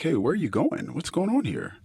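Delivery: high, startled retold questioning; alarmed demand. Husband confronting his wife as she leaves. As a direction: catch her off guard and demand answers.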